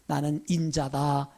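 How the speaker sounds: slow, low and soft, humbly; quiet and lowly, taking no credit; humble, low. Retold or imitated. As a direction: say it as a humble man, slowly. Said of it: "겸손하게 이렇게 말하거든요".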